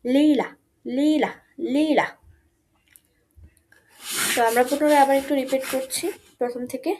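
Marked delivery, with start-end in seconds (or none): 0.1-2.1 লীলা slow and drawn out, like lila; German lila. Shown as a demonstration. Teacher slowly drilling the German word লীলা. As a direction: say লীলা slowly, both syllables clear. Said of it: "বেগুনিকে বলে".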